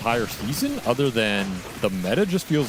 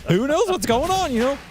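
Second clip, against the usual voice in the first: high, loud goofy clown voice; Quinn's clown voice; 13 semitones higher, 4 dB louder than usual. Retold or imitated. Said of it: "That's a Quinn impression for you".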